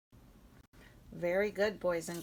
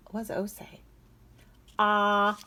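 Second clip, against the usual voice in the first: usual speaking voice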